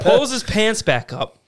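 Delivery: laughing through the words. Real, not acted. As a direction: chuckle out the line. Laughing while telling.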